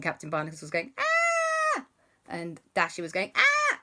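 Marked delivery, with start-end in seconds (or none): 1.0-1.8 very high, loud shriek; high, loud scream of fright; cartoon scream. Captain Barnacles screaming. 3.3-3.8 very high, loud shriek; high, loud scream of fright; cartoon scream. Dasher screaming.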